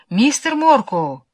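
high, slow muffled call; stifled calling out. A narrator's reading. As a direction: call out his name again, stifled. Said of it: "раздался придушенный голос".

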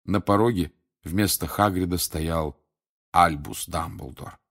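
plain reading voice

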